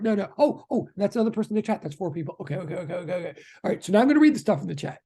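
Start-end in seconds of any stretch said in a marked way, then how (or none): none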